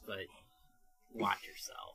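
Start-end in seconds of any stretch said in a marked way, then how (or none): 1.1-2.0 whispered warning, played as a threat; hushed mock menace; mock threatening whisper. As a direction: drop to a whisper to warn them off. Mock warning after calling out bullshit.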